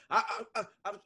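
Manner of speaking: high stammer stuck on "I"; stutter demonstration. Shown as a demonstration. Explaining which word you stutter on, high mock stammer. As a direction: repeat the small first word, pitch rising. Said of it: "which word in the sentence are you stuttering on".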